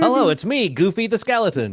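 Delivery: high, cheery cartoon skeleton; goofy skeleton voice. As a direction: play a dopey friendly skeleton. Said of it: "that's the kind of tone that the guy had".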